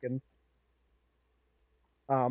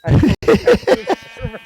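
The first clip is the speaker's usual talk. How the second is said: loud, high, emphatic declaration; convincing shout; 10 semitones higher, 18 dB louder than usual. Shown as a demonstration. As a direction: belt it out with full conviction. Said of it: "Say it more convincingly".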